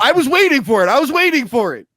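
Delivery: worked up, insisting on it; emphatic insistence. Real, not acted. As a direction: hammer it home with rising energy. Repeating an unmet expectation emphatically.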